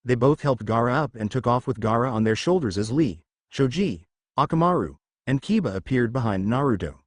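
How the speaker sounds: usual speaking voice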